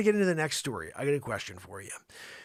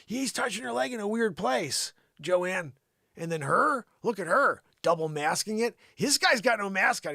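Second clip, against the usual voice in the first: ordinary delivery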